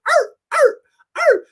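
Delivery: high, loud mock dog barks; barking like a dog. Imitating the father taunting his daughter, mock barking. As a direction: yap out sharp barks in a high voice. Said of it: "he starts barking like a dog".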